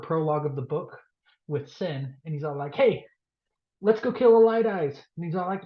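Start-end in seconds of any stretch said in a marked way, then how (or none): 2.7-5.0 mock rallying call. Soldier rallying cry.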